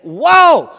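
loud, high burst of amazement; amazed whoa. Acted. Townsfolk seeing the healed man, loud and amazed. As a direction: gasp it out in wonder.

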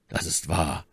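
low, soft man's voice; ruhiger Athos. Acted. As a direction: concede it quietly and low. Athos concedes calmly. (tiefe, leise Männerstimme)

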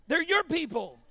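ordinary talk, nothing marked

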